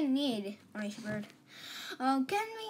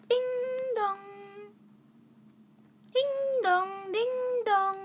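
high sing-song doorbell chime; imitated doorbell; 9 semitones higher than usual. Visitors ring Orange Bird's doorbell, high chiming imitation. Child voices a doorbell in play.

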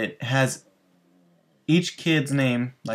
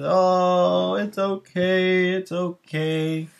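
comforting; 6 semitones higher, much slower than usual. Retold or imitated. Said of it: "And then everybody's".

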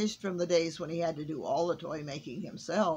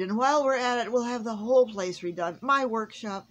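eager Santa; 4 semitones higher, 7 dB louder than usual. A narrator's reading. Santa plans remodel.

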